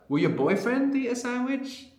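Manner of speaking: put-on high contrastive question stressing "your boyfriend"; "Will your boyfriend eat a sandwich?" with the weight on "your boyfriend", pitched high; emphatic stress. Shown as a demonstration. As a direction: ask it as if he alone matters. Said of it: "Whatever tone you can imagine that makes you stress that".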